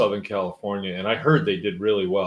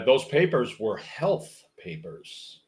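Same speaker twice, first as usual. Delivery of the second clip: ordinary talk, nothing marked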